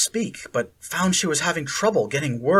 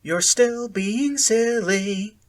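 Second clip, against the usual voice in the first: high, slow lilting sing-song; cheerful sing-song; 8 semitones higher, 3 dB louder, slower than usual. A narrator's reading. Sally Ann brushing off Akira, cheerful sing-song. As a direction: tease her lightly, sing-song. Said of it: "in a cheerful, sing-song voice".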